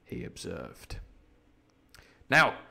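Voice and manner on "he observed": he observed, dropped soft and low; quiet narration. A narrator's reading. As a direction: throw the tag away under the breath. Narration dropped after quote.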